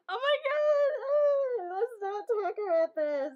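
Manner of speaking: high, loud mock flustered squeal; imitating a flustered friend dodging the topic; embarrassed friend. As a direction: squeal like a boy caught out. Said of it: "the cutest thing ever".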